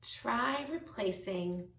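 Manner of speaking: ordinary delivery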